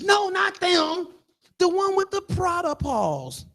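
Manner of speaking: high, very drawn-out words; gossiping lion. Acted. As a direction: play a lion correcting a friend, stretched out and high. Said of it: "can you imagine two lions".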